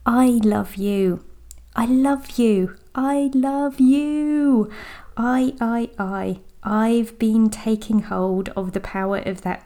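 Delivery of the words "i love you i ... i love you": slow, deliberate I love you with weight on I; stress on I. Shown as a demonstration. Coach savouring the full I love you, slow and stressed. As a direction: claim every word, slowly. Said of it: "I helps us to claim it".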